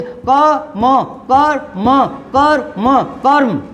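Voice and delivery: कर्म built slowly from क and म, ending "karm"; building up karm. Shown as a demonstration. Teacher to students, slowly assembling कर्म. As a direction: sound out कर म then join into कर्म. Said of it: "इसमें कै और मैं बोलेंगे".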